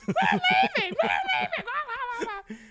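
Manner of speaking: very high, loud mocking shriek; hammed-up gibbering taunt, laughing; jeering uproar. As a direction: squeal it like a whole room jumping up and down. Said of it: "jumping up and down like".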